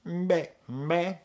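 mimicking the sample's meh; Beaker meh. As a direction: bleat like the Muppet Beaker. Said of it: "there's this person saying".

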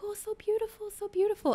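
high-pitched imitation of an admiring grandma. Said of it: "she's like".

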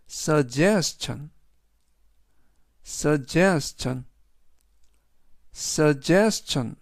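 suggestion drawn out slowly, stress on ge; slow pronunciation model. Shown as a demonstration. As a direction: repeat suggestion slowly for students. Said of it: "이라고 발음하시면 되겠고요". (suggestion, 천천히 반복하며 ge에 강세)

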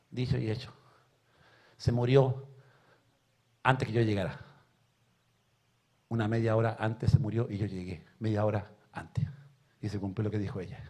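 low, hushed, heavy with loss; subdued grief. Real, not acted. Preacher recalling his mother's death before he arrived, low subdued grief. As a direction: let the voice sink, with long pauses.

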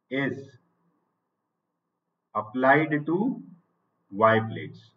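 each phrase said very slowly and spaced out for students to write down; teacher dictating notes at writing speed; slow dictation. Shown as a demonstration.

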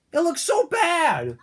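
fed-up viewer crying out at a bad cartoon; exasperated outburst. Real, not acted. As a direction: let the disgust burst out slowly. Outraged at terrible dub.

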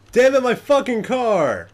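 loud, slow outraged shout at the stolen car; furious yell. Real, not acted. Gamer yelling at the thieves who took his car, furious shout. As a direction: yell it out like your car just vanished.

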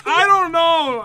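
loud, high protest; flustered outburst. Real, not acted. Flustered apology after a slip-up.

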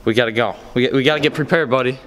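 normal talking voice

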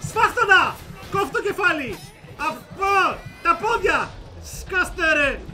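loud, high, short yelled bursts; frenzied gamer war cries; battle cries. Real, not acted. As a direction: yell each strike at the enemies. Attacking enemies with battle cries.

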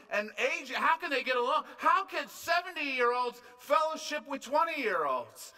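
loud, high, incredulous questions; amazed onlooker. Acted. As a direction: marvel out loud, stretching the key words. Voicing amazed onlookers at church unity.

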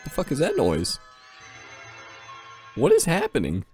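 ordinary delivery

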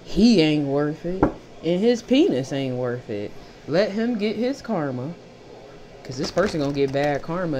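ordinary talk, nothing marked

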